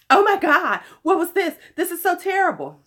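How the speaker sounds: high, loud mock outrage; mocking outraged fans. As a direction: mimic dramatic complainers. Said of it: "everybody is up in arms about that".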